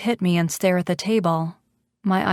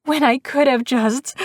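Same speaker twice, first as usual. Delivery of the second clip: hysterical, high and gasping; breathless hysterical build-up; high-pitched and uneven; 7 semitones higher than usual. A narrator's reading. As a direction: push it out high, building to a screech. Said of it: "The words come out high-pitched and uneven".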